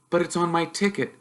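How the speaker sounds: put-on nervous boy asking his uncle; young Harry, unsure and polite; timid boy. Harry protests.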